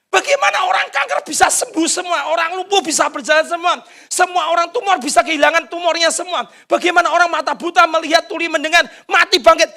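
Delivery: high, crying shout of desperate prayer; acted wailing plea; tearful pleading prayer. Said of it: "Sambil nangis berjam-jam".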